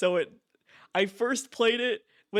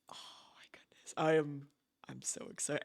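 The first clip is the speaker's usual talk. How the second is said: breathless whisper of excitement; excited whisper; 3 semitones lower, 9 dB quieter, faster than usual. Real, not acted. Streamer to chat about the new game, whispering excitedly. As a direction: whisper it like you can barely contain yourself.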